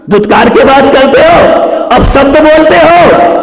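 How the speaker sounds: loud, high rebuke from the pulpit; scolding shout. Real, not acted. Preacher shouting at parents over abusive talk, loud rebuke. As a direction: thunder the accusation at the parents.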